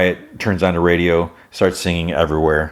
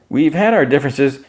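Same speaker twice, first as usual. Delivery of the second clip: small timid voice; 8 semitones higher than usual. Retold or imitated. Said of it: "you gotta use your big boy voice".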